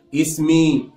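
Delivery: usual speaking voice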